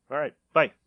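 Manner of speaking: ordinary talk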